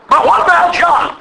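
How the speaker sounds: high, insistent objection; Peter's protest. Retold or imitated. Peter dodging Jesus' question, high insistent protest. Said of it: "Peter's interest is".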